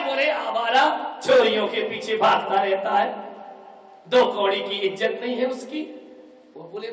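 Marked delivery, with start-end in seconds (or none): 0.3-5.8 scornful shout. Acted. Daughter insults her brother-in-law.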